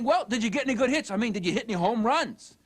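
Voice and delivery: mock eager interrogation; pushy parent. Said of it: "you hear yourself saying".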